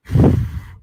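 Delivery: loud unvoiced puff; puff of dust sound. Streamer imitating dust puffing out, loud puff. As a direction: blow out a burst of dust. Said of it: "This is what it sounds like when Nancy Pelosi squeezes her titty".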